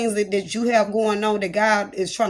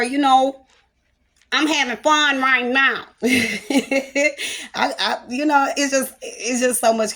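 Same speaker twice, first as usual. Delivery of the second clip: put-on higher, louder playful kid voice, slow; little play voice; 6 semitones higher, 4 dB louder, slower than usual. Shown as a demonstration. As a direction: play the big kid having fun. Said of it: "I may go into my little play voice".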